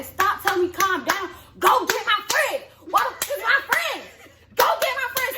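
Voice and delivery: high-pitched, insistent demand; retold yelling. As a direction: re-enact yelling at those holding you back, pitch climbing. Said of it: "the only thing I'm yelling".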